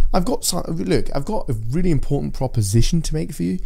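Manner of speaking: high Queen's English sales pitch; posh Queen's English. Shown as a demonstration. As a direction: pitch the deal like a royal broker. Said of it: "I say".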